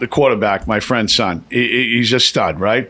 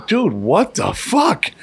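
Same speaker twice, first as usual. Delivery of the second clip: stunned; 7 semitones higher, much slower than usual. Retold or imitated. Stunned after the game.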